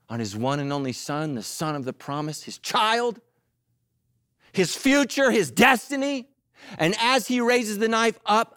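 usual speaking voice

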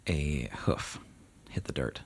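hushed, breathy narration; hushed eerie hoofbeat reveal; suspenseful whisper. Acted. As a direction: whisper it to build dread.

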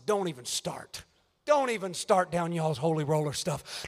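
low, soft scoffing brush-off; dismissive Thomas. Acted. Thomas to excited disciples, lightly voiced scoffing. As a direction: wave them off, unimpressed.

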